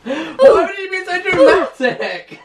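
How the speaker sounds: loud laughing shout of triumph. Real, not acted. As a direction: crack up as you reveal the prank. Prank reveal, laughing at him.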